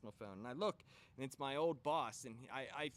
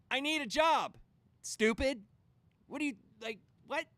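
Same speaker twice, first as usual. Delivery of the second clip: loud, much higher angry yell; furious retort; 3 semitones higher, 8 dB louder than usual. Retold or imitated. Speaker yelling at HR about needing a job, furious. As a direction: tear into her.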